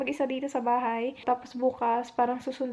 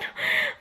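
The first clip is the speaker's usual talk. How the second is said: breathless sob. Real, not acted. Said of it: "iyak ako na iyak".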